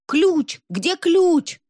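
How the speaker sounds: loud, high-pitched cry; frantic shout for the key; yelling. A narrator's reading. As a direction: yell it out urgently, pitch way up. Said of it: "вопил кто-то".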